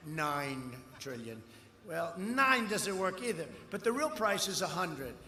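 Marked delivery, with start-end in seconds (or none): 0.1-1.4 mocking opponents. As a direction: scoff at their cheap estimate. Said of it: "they say".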